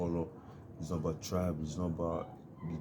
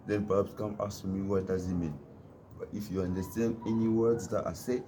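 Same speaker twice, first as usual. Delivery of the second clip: usual speaking voice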